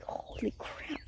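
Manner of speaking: breathless hushed whisper; scared whisper alone in the dark. Retold or imitated. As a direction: whisper it as if the thing might hear. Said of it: "So I was like".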